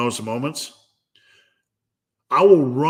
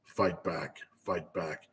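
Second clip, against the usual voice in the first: much lower and softer, a low urging voice; low, soft fighting voice; 7 semitones lower, 11 dB quieter than usual. Acted. Inner voice urging himself low and soft to fight back. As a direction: press it out low and quiet. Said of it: "There's two voices".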